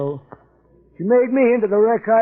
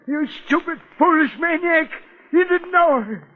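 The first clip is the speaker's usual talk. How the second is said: furious outburst; 9 semitones higher than usual. Acted. Brother ordering the detective out, building from seething to screaming fury. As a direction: lash out until your voice cracks into a scream.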